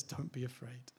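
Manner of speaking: very soft, gentle comfort; nearly breathed reassurance. Acted. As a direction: hush it like calming a child. God's reassurance, first take.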